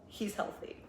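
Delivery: near-whispered retold reply; hushed verdict. Therapist telling client about her boyfriend, hushed verdict. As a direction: drop to a soft, confiding whisper.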